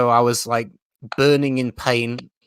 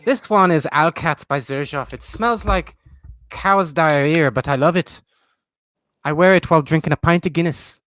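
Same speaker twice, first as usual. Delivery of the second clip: lightly put-on Irish accent, a bit higher; a playful mock-Irish potato host; 5 semitones higher than usual. Hosting a pretend channel as Robert the Potato, mock Irish. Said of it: "With an Irish accent".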